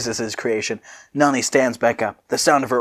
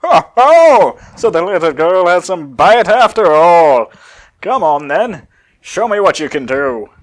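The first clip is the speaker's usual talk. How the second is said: loud amused villain taunt; loud villain goading the girl; taunting Cell; 6 semitones higher, 8 dB louder than usual. Acted. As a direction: laugh and dare her loudly.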